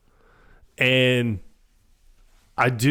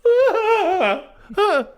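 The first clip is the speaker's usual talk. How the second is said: high-pitched comic shriek from another room; high mock scream; 4 dB louder than usual. Man hidden in the bathroom as something breaks, mock scream. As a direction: imitate a muffled, hammed-up scream. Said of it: "and he's like".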